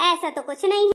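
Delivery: ordinary talk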